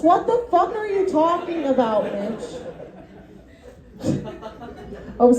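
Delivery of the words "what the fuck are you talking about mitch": higher, exasperated disbelief; incredulous. Retold or imitated. Retelling disbelief at Mitch's ghost explanation, higher and incredulous. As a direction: throw it back at him, higher and stunned. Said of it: "I was like".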